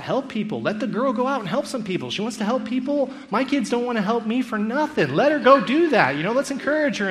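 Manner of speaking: acted outburst, loud and high; emphatic urging. Acted viewer urging the girl on.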